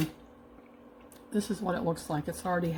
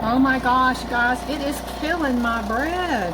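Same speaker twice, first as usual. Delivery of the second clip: exasperated exclamation; 5 semitones higher, 7 dB louder than usual. Real, not acted. Sealer crushes bread again.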